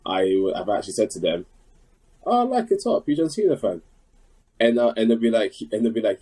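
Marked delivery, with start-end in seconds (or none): 2.2-3.8 mock friendly greeting. Retelling greeting a fan at gym.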